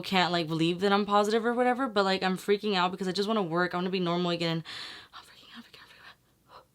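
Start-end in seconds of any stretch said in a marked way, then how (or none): 5.1-6.6 barely audible, voiceless whisper; panicked whisper. Real, not acted. Vlogger awaiting test results, panicked whisper.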